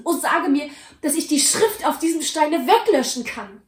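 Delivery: high, loud, frantic vowing; fearful vow. Acted. Scrooge in fear, vowing to the Ghost to honour Christmas. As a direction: swear to change, frantic with fear. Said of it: "In seiner Angst ergriff er".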